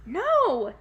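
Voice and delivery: a sharp, high, loud panicked no; panicked cry. Acted. Jack panics as pixies steal the beans, loud high cry. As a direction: cry out in panic as the jar is stolen. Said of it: "Jack cried in panic".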